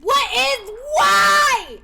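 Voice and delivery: high, loud wail of protest; loud, high-pitched outburst; exasperated shout. Real, not acted. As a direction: cry out in exasperation. Exclaiming in mock despair over game.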